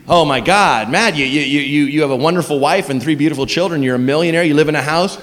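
low, soft chiding self-talk; mock inner voice. Talking to himself, a lightly voiced low chiding.

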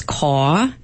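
caw, the Yat way: no r at the end, long aw. Shown as a demonstration. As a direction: say caw with the r dropped, an open aw vowel. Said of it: "saying car as caw".

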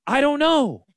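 high, bewildered I don't know; a high mock shrug of confusion; mock confused shrug. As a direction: toss it off like a clueless worrier. Mock anxious investor.

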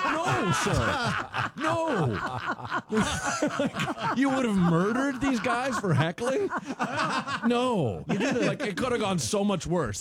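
high, laughing protest; laughing disbelief. Real, not acted. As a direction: laugh it off in shock.

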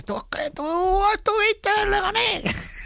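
very high, stretched-out caricature; funny fan voice. Retold or imitated. Comedian imitating fans who recognise him, comic high voice. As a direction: mimic a fan hollering in a drawn-out falsetto. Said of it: "te lo dicen todo con esas voces".

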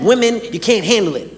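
usual speaking voice

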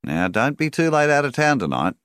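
banker Rawlings. A narrator's reading. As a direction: address a customer as the banker, pitched well up. Banker Rawlings talking to his customer.

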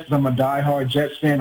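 normal talking voice

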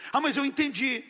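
high, dismissive mock retort; lightly mocked stubborn reply; stubborn listener. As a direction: brush the protest off. Acted misunderstanding argument.

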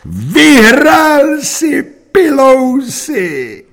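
very high, drawn-out shout; triumphant cry. A narrator's reading. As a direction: shout it slowly, soaring in pitch. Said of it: "vykřikl jsem".

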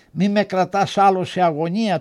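plain reading voice

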